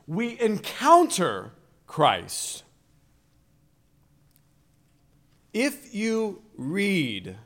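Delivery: loud, high preaching with deliberate pauses; emphatic preaching. Real, not acted. Preacher urging listeners to read the Scriptures, emphatic preaching. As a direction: preach it slowly and forcefully, phrase by phrase.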